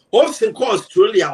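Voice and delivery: ordinary delivery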